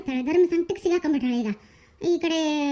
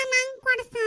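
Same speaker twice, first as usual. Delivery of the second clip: put-on high whiny child voice; begging child; 6 semitones higher than usual. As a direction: plead for it like a small girl. Child begging father for treat. (ಮಗುವಿನ ಹಠದ ಬೇಡಿಕೆ)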